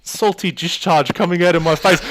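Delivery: high, loud comic alarm at crying; mock tearful bewilderment. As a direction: imitate someone baffled by their own tears. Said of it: "he started crying".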